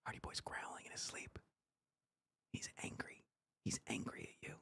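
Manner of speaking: soft unvoiced whisper. Real, not acted. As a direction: whisper so the dog keeps sleeping. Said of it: "whispering so the dog sleeps".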